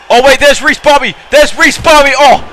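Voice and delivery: high, loud sudden alarm; surprised shout. Real, not acted. Commentator spotting Reese Bobby's run-in, startled. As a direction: caught off guard by the run-in.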